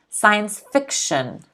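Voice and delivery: slow Science fiction, stress on FIC. Shown as a demonstration. Teacher repeats Science fiction for students, stressing FIC. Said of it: "Notice the stress is on the fi".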